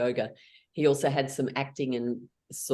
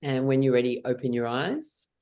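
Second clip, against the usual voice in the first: guided meditation; 2 semitones lower than usual. Acted. Leader guides the group through a calm meditation. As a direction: guide them gently inward.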